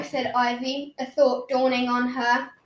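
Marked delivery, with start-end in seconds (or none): none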